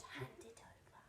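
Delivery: hissed whispered command; whispered order. Acted. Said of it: "but he ordered".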